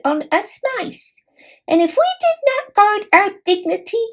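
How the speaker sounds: high squeaky voice of a dignified little mouse; a tiny knight, formal and put out; proud mouse knight. A narrator's reading.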